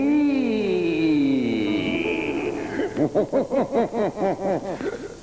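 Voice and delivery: evil cackle. Acted. Horror host cackle.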